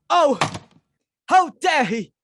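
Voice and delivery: loud, high indignant cry; high-pitched outrage; outraged. Acted. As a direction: rage at the insult. Outraged hostess after cancellation.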